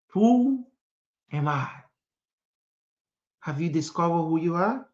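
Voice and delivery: usual speaking voice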